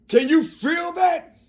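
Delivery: slow, high, shouted challenge to the audience; emphatic shout. Real, not acted. Passionate appeal to viewers.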